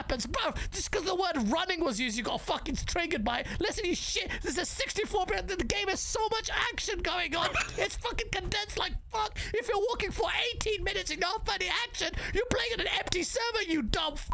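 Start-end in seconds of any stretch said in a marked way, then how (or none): 3.6-14.3 high-pitched, worked-up scolding; angry rant. Real, not acted. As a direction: lay into the critics, pitch way up. Heated rant at game critics.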